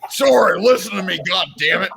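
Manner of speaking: shouted, high and loud; loud outburst. Real, not acted. Ranting at laughing friends, loud outburst. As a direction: yell to cut through the laughing.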